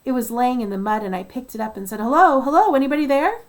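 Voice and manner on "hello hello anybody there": higher and much louder, hailing; calling out. Retold or imitated. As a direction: call up the pipe hoping someone answers. Calling into the rescue probe.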